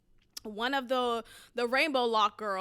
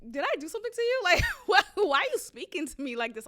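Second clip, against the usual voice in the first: indignant comeback; 4 semitones higher than usual. Retold or imitated. Acted comeback to rude interviewer.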